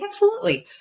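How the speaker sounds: bright, high, eager absolutely; high upbeat coach's reply; eager yes. Retold or imitated. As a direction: chirp it like a keen coach.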